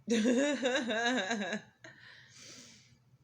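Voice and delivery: loud high burst of laughter; big laugh. Real, not acted. Laughing at own joke.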